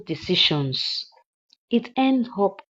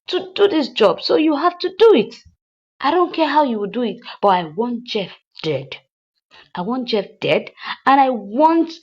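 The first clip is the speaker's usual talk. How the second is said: loud, higher mock tirade; imitating angry insistence; 5 semitones higher, 8 dB louder than usual. Madam Uchechi insisting the hitman finish the job, mock angry. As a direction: hammer home that she paid him.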